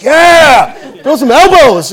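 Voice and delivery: loud, high whooping encouragement; cheering on. Retold or imitated. Coach urges brawling players on at practice, excited shout. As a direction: re-enact egging the players on at a shout.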